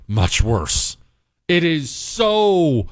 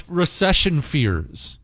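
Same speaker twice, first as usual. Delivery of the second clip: usual speaking voice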